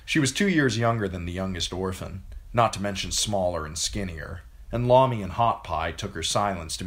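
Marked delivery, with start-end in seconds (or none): none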